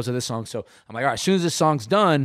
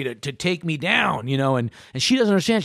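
normal talking voice

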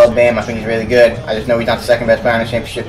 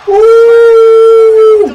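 long, very high "ooh" scream; shrieked celebration; excited whoop; 7 dB louder than usual. Real, not acted. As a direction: let out a loud, sustained whoop. Celebrating a made shot.

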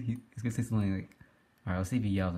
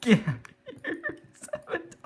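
helpless laughter. Real, not acted. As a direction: squeeze the word out through laughing. Laughing at sabotage in game.

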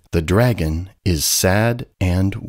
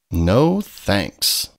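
ordinary delivery

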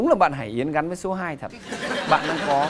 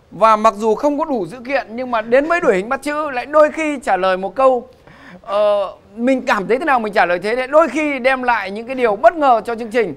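raised, loud hype; excited host; 7 semitones higher, 8 dB louder than usual. Real, not acted. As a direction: whip up the applause. Host hypes crowd for applause.

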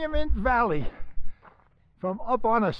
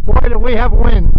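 shouting over wind; 3 semitones higher, 15 dB louder than usual. Real, not acted.

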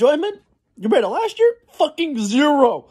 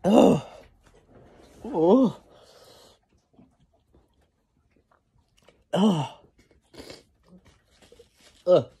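groaning with a stomach ache; low pained groans, clutching belly; stomach cramps; 4 semitones lower, 4 dB quieter than usual. Real, not acted. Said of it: "my stomach hurts".

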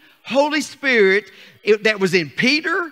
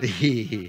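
soft low chuckle; 11 semitones lower, 6 dB quieter than usual. Real, not acted. Laughter after a joke.

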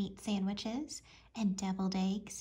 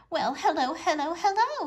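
louder, higher hearty hello; hearty greeting cry; 7 semitones higher, 7 dB louder than usual. A narrator's reading. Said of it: "he cried".

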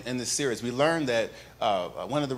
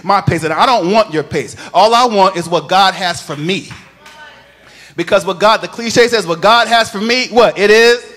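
full-force preaching; 5 semitones higher, 11 dB louder than usual. Acted. Pastor firing up the congregation about healing, loud chanted preaching. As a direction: lift the whole church with a sung, rising proclamation.

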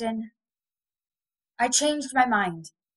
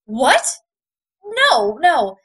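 indignant shout; 5 semitones higher, 8 dB louder than usual. Acted. She objects to Austin.

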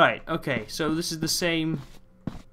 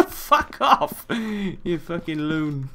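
laughing brush-off; much slower than usual. Real, not acted. Laughing at an NPC.

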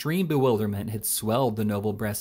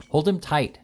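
higher, slightly louder call; mild shout; 5 semitones higher, 4 dB louder than usual. A narrator's reading. Snodgrass ordering the company to restrain Pickwick, mild shout. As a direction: call out urgently, short of a full shout. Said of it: "shouted Mister Snodgrass".

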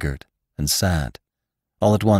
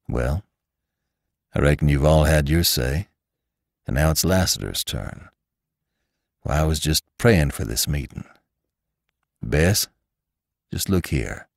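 gentle Lassiter; 5 semitones lower, 3 dB quieter than usual. Acted. Lassiter takes his turn with the group, low and kindly.

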